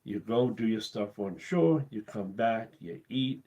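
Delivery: usual speaking voice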